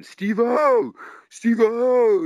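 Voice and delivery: high, loud heckler yell. Retold or imitated. Drunk heckler yelling at the comedian on stage. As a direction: bellow the name across the club. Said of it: "He's ge-, yelling".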